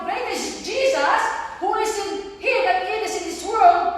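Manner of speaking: slow, loud, high preaching; declaiming scripture. Acted. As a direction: proclaim the verse slowly.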